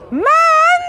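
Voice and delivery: Man! yelped high and loud; a squeaky fed-up student; mock high exasperated yelp. Teacher playing a student, high mock yelp. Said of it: "You asked the question, bro".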